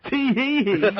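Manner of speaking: high, chirpy cheesy DJ; mock a perky radio host; fake radio DJ voice. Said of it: "their fake radio voice".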